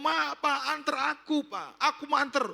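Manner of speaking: put-on very high, insistent child's begging; imitated tearful pleading. Crying daughter begs father for a ride, imitated high plea. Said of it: "Anaknya nangis terus".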